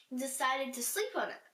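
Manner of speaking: ordinary delivery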